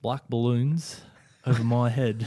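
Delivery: lyrics read in a hammed Kiwi accent; New Zealand accent. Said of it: "read all the lyrics in a New Zealand accent".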